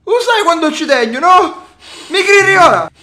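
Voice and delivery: high, loud near-crying pleas; pleading shouts. Real, not acted. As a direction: beg him to believe you. Grandson swears it was a joke.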